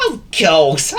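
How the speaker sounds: high, loud exclamation into laughter; cartoon dog guffawing; laughing dog. A narrator's reading. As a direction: realise it, then burst out laughing. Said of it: "laughed Willie".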